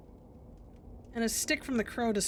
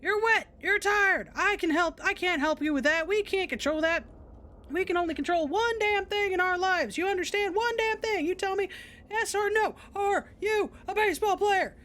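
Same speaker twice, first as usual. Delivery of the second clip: screaming coach; 6 semitones higher than usual. Acted. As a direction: bark it like a drill coach. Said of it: "screams into the blades".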